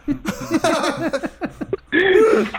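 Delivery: high burst of laughter; real laughter breaking out; laughing. Real, not acted. Cracking up telling friends about the dog, laughing.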